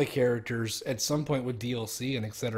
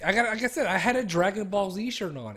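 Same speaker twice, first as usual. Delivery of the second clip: normal talking voice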